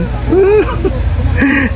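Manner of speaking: sudden high-pitched burst of laughter. Real, not acted. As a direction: break into a squealing laugh. Laughing after her own joke.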